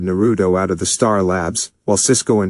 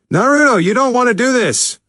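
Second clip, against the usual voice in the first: very high, loud pleading yell; urgent shout; 4 dB louder than usual. A narrator's reading. Barry yelling while dragging Naruto off the prisoner. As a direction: shout him down as they drag him off. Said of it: "Barry shouted".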